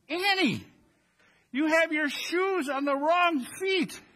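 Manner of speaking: high, startled exclaim; exclaiming mom. Retold or imitated. Mom spotting her child's shoes in church, exclaiming high. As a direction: catch the mistake with a gasp of alarm.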